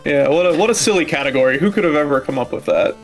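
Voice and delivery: ordinary talk, nothing marked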